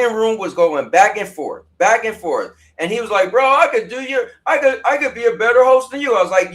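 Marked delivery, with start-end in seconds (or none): 3.3-6.2 higher-pitched retold bragging; lightly mock a boastful rival. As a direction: mimic a friend's cocky challenge. Acting out Rune's boast in retelling.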